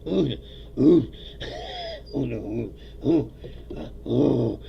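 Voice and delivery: playful caveman grunting, ooh over and over; caveman grunts. Pretend. Streamer mocking dumbed-down talk with caveman grunts. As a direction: grunt like a caveman, half laughing.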